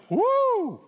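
high, loud-ish mock whoop; long high "woo" as the crowd; imitating crowd cheer. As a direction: whoop like a delighted ballpark crowd. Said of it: "Crowd went".

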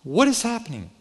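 high-pitched, bewildered disbelief; retold astonishment. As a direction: pitch leaps up in comic shock. Retold shock at huge offering.

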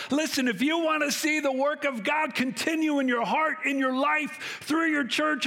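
high, urgent preaching voice; preaching at full force. Acted. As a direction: drive the command home to the congregation. Preacher raising voice to congregation.

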